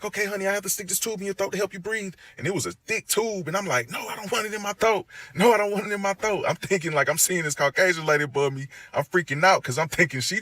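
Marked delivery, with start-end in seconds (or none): 0.0-2.1 high, fast, soothing nurse voice; nurse's quick reassurance. Retold or imitated. Nurse telling the patient about the tube, high quick soothing voice. 3.9-6.5 high, panicked "no" said twice; panicked refusal. Retold or imitated. Patient refusing the tube from the nurse, high panicked protest.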